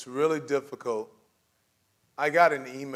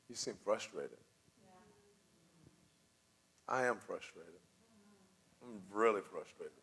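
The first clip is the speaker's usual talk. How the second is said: gentle demo; 5 semitones lower, 11 dB quieter than usual. Shown as a demonstration. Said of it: "You know what's a really good response?".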